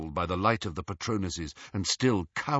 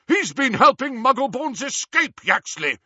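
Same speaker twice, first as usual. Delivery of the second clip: loud high urgent shout; 13 semitones higher, 6 dB louder than usual. A narrator's reading. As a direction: shout it across the hall. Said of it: "Harry shouted".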